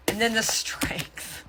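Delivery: slow, hushed whisper. Real, not acted. Tarot reader unveiling a card to the viewer, whispered slowly. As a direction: breathe the card name out slowly like a secret.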